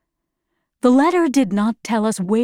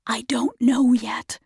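whisper. Acted. Andrews answers Lina in secret, whispered. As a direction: barely breathe the words. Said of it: "he whispered".

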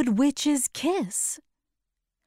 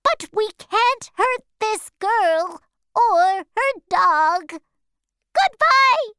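much higher, building louder to the goodbye; shrill monkey goodbye; 10 semitones higher, 4 dB louder than usual. Acted. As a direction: squawk the farewell like a flying monkey. Monkey reports, then leaves.

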